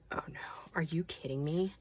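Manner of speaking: breathy whisper, oh no; whispered disbelief. Retold or imitated. Mom retelling her daughter's call, whispering in disbelief. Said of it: "I was like".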